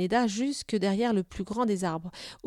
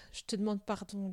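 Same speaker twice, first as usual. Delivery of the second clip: very hushed, contrite voice; quiet, intimate regret; soft apology; 10 dB quieter than usual. A narrator's reading. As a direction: apologise tenderly under the breath. Apologises tenderly to his horse.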